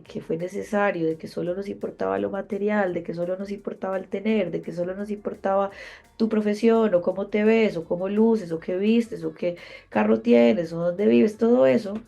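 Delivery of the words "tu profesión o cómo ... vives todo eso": louder and louder list; rising intensity. Real, not acted. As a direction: build it up like a preacher. Building sermon-like list. (enumeración cada vez más fuerte)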